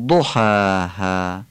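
ضحاها very slow, a heavy ض and a long final ها; Quranic recitation. Shown as a demonstration. Teacher demonstrates ضحاها to students, very slow. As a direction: model ضحاها carefully. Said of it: "باید فصیح بگیم".

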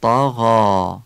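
طاغا with dark vowels and a soft flowing gh; heavy but soft. Shown as a demonstration. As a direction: draw out طاغا, full vowels, gentle غ. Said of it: "درشته ولی نرمه".

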